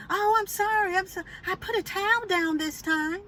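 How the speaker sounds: high-pitched flustered excuse; apologetic neighbor. Retold or imitated. Neighbor explaining about the towel, high mock-apologetic. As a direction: mimic her apology way up high.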